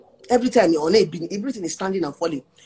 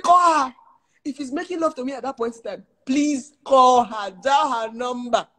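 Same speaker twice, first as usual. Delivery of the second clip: high, slowed, shouted dare; mock outraged dare; 5 semitones higher than usual. Wife daring her husband to call his side chick, mock shriek. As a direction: dare him, half-shrieking.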